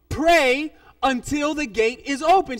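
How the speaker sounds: slow, loud "Pray until the gate is open"; insistent repetition. Retold or imitated. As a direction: drive home "Pray until the gate is open", stretching each word. Pastor repeats, losing patience.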